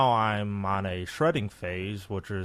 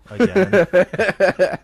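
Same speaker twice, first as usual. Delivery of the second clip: slow, loud, drawn-out echo; mock slow repeat; 12 semitones higher, 15 dB louder, much slower than usual. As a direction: drag out the word again.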